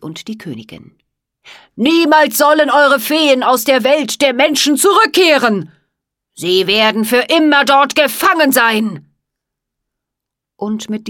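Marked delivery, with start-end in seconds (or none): 1.7-9.1 evil curse. A narrator's reading. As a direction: pronounce doom on the whole fairy land. Jack Frost addresses king, queen.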